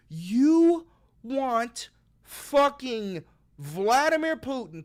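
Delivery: slow, loud, dragged out; slow incredulous stretch. Real, not acted. Vlogger drags out outrage at Putin in the Vatican. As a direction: stretch every word in outrage.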